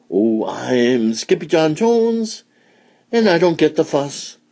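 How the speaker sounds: high kitten voice chanting a rhyme; playful kitten. A narrator's reading. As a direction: bounce through it in a high voice. Kitten bounces about reciting rhyme.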